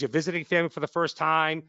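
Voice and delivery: normal talking voice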